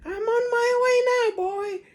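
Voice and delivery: put-on very high, loud cackling old woman; screechy crone. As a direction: cry out like a greedy hag. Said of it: "she called down to George".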